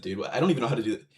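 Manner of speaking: usual speaking voice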